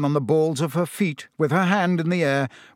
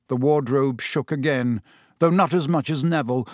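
ordinary delivery, nothing marked

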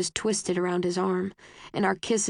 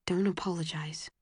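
firm but whispered; hushed, a little stern; almost stern whisper; 2 semitones lower, 6 dB quieter than usual. A narrator's reading. Boy firmly hushing her apology. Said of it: "He says almost sternly".

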